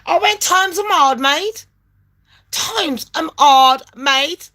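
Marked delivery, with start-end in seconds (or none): 0.4-1.7 high-pitched retold protest; pleading retort. Caller pleads hardship to phone agent, high-pitched protest. Retold plea, first take. 2.5-4.5 drawn-out, word-by-word repeat; slow right down on each word; emphatic slow repeat. Retold or imitated. Caller repeats hardship to phone agent, slow and emphatic.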